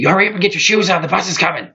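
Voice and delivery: high-pitched imitation of a pushy mom; mock nagging mom. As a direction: rush the kid, shrill and impatient. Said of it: "her response was to tell me".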